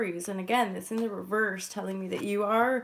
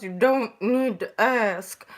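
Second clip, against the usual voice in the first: louder, slow put-on drawl of some unplaced accent; unidentified put-on accent; 8 dB louder, slower than usual. Greeting viewers on a break from editing, in a hammed-up unplaced accent. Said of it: "I don't know what accent that was".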